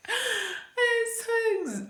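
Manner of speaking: very high, slow, near-crying squeal; breaking into a happy wail; overjoyed squeal. Real, not acted. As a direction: voice cracking with joy. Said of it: "nimefurahi sana".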